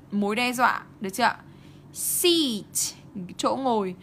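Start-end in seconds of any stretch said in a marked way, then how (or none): 1.9-2.9 SEAT with a long ee, pitched higher. Shown as a demonstration. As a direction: stretch the ee in "Seat". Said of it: "Cái này là y dài".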